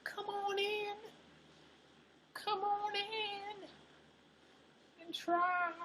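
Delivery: high-pitched, drawn-out Southern drawl with long vowels; mock Southern accent. Streamer mimicking the chicken ad's Southern invite, mock Southern accent. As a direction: stretch the vowels like a hammed-up Southern chicken mascot. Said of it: "Southern accent".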